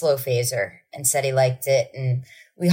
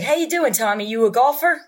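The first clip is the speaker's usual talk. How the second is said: much higher, bluff dad greeting; hearty dad; 7 semitones higher than usual. Retold or imitated. Mimicking dad greeting Tommy, hearty and high. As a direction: play the cheery dad.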